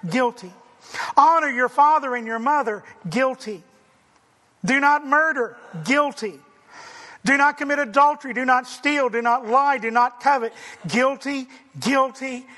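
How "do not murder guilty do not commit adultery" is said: normal talking voice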